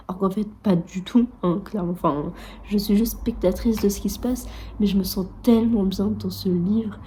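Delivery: ordinary talk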